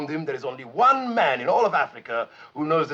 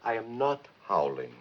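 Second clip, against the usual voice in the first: softly controlled, no howl at all; restrained denial; 3 semitones lower, 7 dB quieter than usual. Acted. Told to stop howling, softly restrained denial. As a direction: hold the voice down to prove it. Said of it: "stop howling and get out of here".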